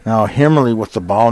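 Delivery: ordinary delivery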